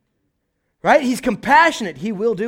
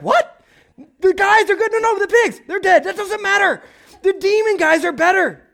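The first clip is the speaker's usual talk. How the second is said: much higher, louder, squeaky back-and-forth; shocked gossip; 4 dB louder than usual. Retold or imitated. Townsperson squealing that the pigs are dead but the men are healed.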